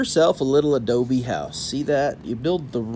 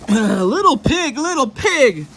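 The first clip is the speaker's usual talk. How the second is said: loud, slow, higher coyote calling; first coyote voice; 10 semitones higher, 7 dB louder than usual. Acted. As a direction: coyote calls at the door, loud and high before changing voice. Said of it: "I'll change the voice".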